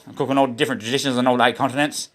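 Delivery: ordinary talk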